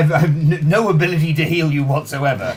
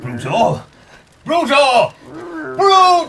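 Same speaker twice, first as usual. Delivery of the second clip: escalating exclamation; 11 semitones higher, slower than usual. Real, not acted. Building excited exclamations.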